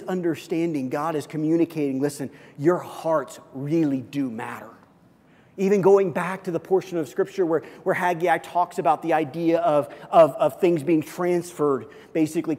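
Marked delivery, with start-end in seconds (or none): none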